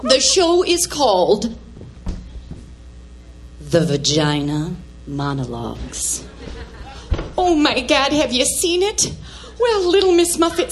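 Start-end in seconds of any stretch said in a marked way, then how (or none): 3.6-6.2 low, reluctant voice for a taboo title; forcing it out. Acted. Embarrassed mother finally naming the show, forcing it out low. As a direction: drop very low and push the words out. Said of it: "I just gotta spit it out".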